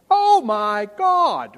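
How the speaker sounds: loud, high, drawn-out gasping reveal; gushing mock amazement. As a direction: imitate an overwhelmed makeover-show homeowner, slow and loud. Imitates makeover show homeowners' reaction.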